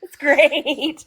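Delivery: laughing through the words. Real, not acted. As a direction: giggle while saying it. Both laughing about green screen.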